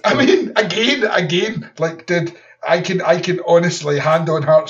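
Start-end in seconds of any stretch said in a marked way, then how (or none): 0.1-1.7 laughing. Real, not acted. Laughing at the Drago quote.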